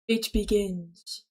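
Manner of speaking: ordinary talk, nothing marked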